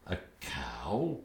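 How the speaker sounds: shocked breathless whisper. Acted. Father repeating the insult, stunned whisper. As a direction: gasp the words in disbelief, unvoiced.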